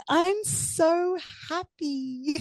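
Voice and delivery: drawn out like you're floating; euphoric, stretched words; blissed out. Retold or imitated. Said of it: "just going".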